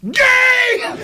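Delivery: sudden high yelled heckle; heckler's shout. Retold or imitated. Heckler in Oklahoma crowd interrupting the comic, shouting. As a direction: bark it out from the back of the room.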